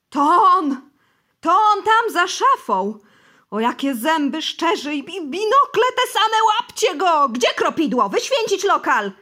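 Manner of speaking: very loud, high, terrified shrieking; wild scream. Acted. Bosy screams in terror at the devil in the room. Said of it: "od dzikiego wrzasku Nikanora Iwanowicza".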